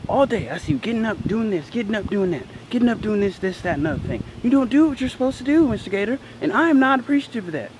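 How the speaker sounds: high-pitched mock telling-off; scolding teacher. Teacher tells off pupil for getting up, mock scolding. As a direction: rant like a fed-up teacher listing offences.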